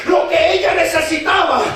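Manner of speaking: fervent preaching in driving parallel phrases; prédica a viva voz. Real, not acted. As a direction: build to an impassioned climax. (predicación encendida)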